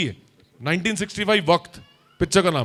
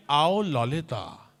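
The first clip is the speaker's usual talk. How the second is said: slow, lower oily call; Shakti Kapoor line; 4 semitones lower, 6 dB quieter, much slower than usual. Shown as a demonstration. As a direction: lure her in a villain voice. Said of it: "इतना ही बोलिए". (धीमी, चिपचिपी पुकार)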